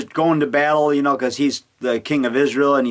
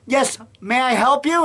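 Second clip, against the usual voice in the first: higher, laughing mock polite; prank caller; 9 semitones higher than usual. Prank calling the ministry, higher and laughing. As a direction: giggle through the polite question. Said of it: "I say".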